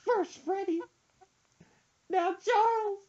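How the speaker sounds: high crying falsetto why's; mock wailing. Son imitating mom sobbing over fish, high falsetto. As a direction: sob each why in a high voice.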